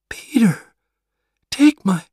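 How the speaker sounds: a last whispered call to her son; breathless whisper. Acted. Dying mother calls, then breaks off.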